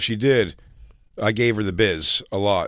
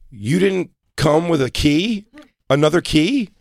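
slow, higher, stunned question; disbelieving, drawn out; incredulous; 6 semitones higher, slower than usual. Retold or imitated. Jay retelling his disbelief to the woman, slow and high.

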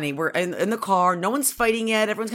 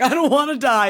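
mock crying child, higher and louder; son crying; 5 semitones higher, 5 dB louder than usual. Son wailing that he doesn't want to die, mock crying. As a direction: imitate a wailing little boy. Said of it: "And then he started crying".

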